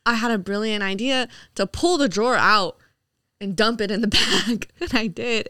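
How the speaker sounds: normal talking voice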